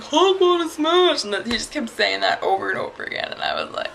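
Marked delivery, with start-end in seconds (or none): 0.0-1.2 slow, loud, high mock monster roar; Hulk. Friend of the costumed guy goofing around, loud Hulk imitation. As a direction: bellow it slowly like a costumed fan playing the brute. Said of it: "the other guy's like".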